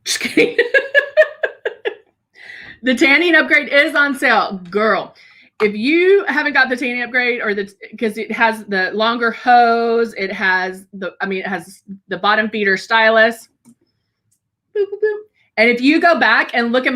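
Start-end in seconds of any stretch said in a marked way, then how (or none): 0.6-1.9 high, bright burst of laughter; real high laugh; squealing laugh. Real, not acted. Laugh after joke. 14.7-15.2 high sing-song boop sounds; mimicking the airbrush pulse; playful boops. Shown as a demonstration.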